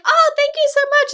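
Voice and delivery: high, loud gushing thanks; imitating the fan's gushing thanks. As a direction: thank them like a thrilled fan. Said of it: "She's like".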